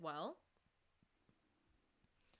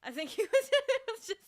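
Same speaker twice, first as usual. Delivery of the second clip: laughing; 8 semitones higher, 9 dB louder than usual. Real, not acted. Laughing while talking.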